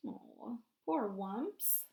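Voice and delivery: ordinary delivery, nothing marked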